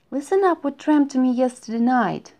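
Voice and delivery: bursting to tell the dream; exclaiming. A narrator's reading. Hare bursts out eagerly to his friends, excited. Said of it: "exclaimed Hare".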